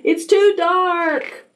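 put-on very high, drawn-out child complaint; complaining little owl. A narrator's reading. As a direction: stretch it out high like a whining child.

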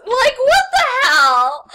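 slow, loud, laughing what the hell; cracking up in disbelief. Real, not acted. Reacting to the fanfic line, laughing loudly.

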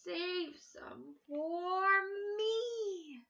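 slow, drawn-out cry; slow farmer pleading for apples; farmer's plea. Acted. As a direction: stretch it out pleading. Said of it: "cried the farmer".

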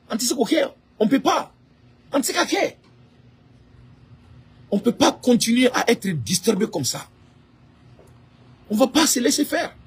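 high-pitched protest without shouting; real exasperation, pitched high; indignant refusal. Real, not acted.

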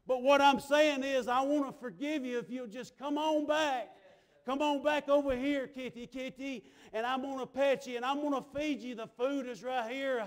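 rising revival-sermon cadence; fervent preaching. Real, not acted. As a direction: preach it urgently, calling the lost back home. Preaching as the Lord calling back.

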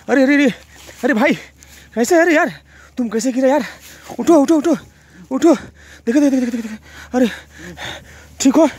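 high, loud panicked cries; urgent high yells to wake him; alarmed shouting. Real, not acted. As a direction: panic as your partner falls. Partner collapses, he panics.

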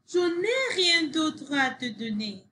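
high, mildly cross protest; understated storybook anger; angry. A narrator's reading. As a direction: protest in a high voice, falling away. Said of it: "Sophie se mit en colère".

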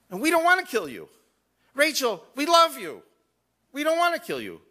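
high, loud imploring voice; pleading retold plea. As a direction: beg them as the gas station men would. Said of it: "and they said to her".

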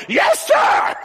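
very high, loud mimicked shout; revival shout. Preacher mimics revival preacher's reply, shouted approval. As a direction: holler it like an excited preacher. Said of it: "Preacher thought he was shouting and went".

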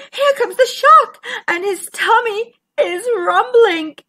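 high-pitched flustered alarm; flustered fishes. Acted. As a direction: cry out in a fluster. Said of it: "fishes were flustered and bumbling".

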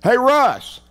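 rising shouts of a name; hollering. Retold or imitated. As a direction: holler twice after a friend. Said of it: "and I holler".

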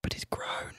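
hushed, breathy aside; whisper. Acted. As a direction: whisper it like a secret. Teasing whisper about the beetroot.

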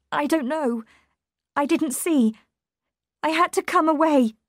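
high, distraught voice; distressed. A narrator's reading. Shaken Mary answers after finding body.

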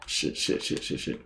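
rapid whispered cursing; panicked whisper. Real, not acted. Streamer in trouble mid-game, cursing in a whisper. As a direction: hiss it over and over under your breath.

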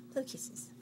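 whispered coaxing, no voice. Real, not acted. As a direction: whisper it like a secret. Coaxing baby to blow kisses.

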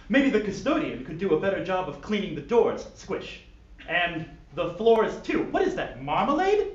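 fussy, disgusted posh gentleman. Acted. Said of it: "yet posh voice".

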